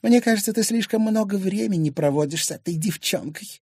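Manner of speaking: high-pitched disdainful reproach; disdainful wife. Acted. Wife resenting time spent with the girl, disdainful. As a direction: reproach him in a high, scornful voice. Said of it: "презрительно скривила губы".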